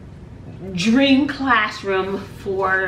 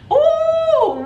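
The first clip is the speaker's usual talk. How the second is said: surprised squeal; 5 dB louder than usual. Real, not acted. Shocked by the memory.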